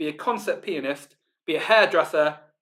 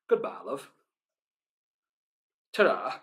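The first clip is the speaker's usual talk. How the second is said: casual northern English farewell, soft; northern accent; 5 dB quieter than usual. Shown as a demonstration. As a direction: say it like a northern goodbye to a friend. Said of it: "With my northern accent, it just sounds like I'm saying".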